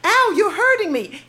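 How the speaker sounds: put-on light Canadian accent, a high yelp on Ow; cute Canadian accent. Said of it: "in a very cute accent".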